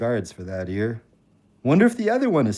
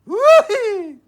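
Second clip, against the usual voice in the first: high, loud whoop of delight; joyful whoop; 10 dB louder than usual. Acted. Husband home after nine months, whooping with joy.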